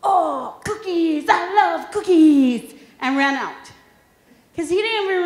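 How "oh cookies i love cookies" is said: put-on higher, slow, loud delighted boy; imitating her son spotting cookies; excited kid. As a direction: savour the cookies like an older kid. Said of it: "he just said".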